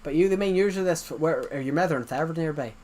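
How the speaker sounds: imitating an Irish scam caller; quick recap in an Irish accent; Irish-accented phone questions. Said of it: "I can do the Irish accent pretty well".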